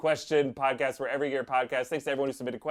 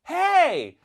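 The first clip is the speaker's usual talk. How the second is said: put-on high, loud kid's yell; mock yell of a kid nearly tripped; indignant kid; 14 semitones higher, 6 dB louder than usual. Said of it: "almost caused him to trip".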